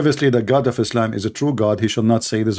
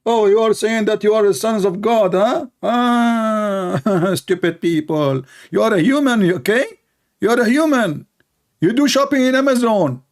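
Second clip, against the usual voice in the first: high, mocking sneer; lightly mock a scornful preacher; mocking imitation; 8 semitones higher than usual. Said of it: "He throw words".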